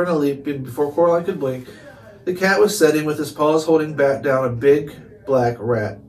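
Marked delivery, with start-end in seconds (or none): none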